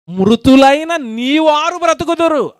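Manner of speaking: solemn verse reading; solemn scripture reading. Acted. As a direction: proclaim it slowly from the pulpit. Reads the verse aloud.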